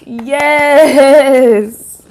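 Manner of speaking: long, drawn-out, very loud yes; acted crowd cheer. People cheering as you pop out, elated drawn-out cheer. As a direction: stretch it into a big hyped-up cheer. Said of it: "That's how they feel".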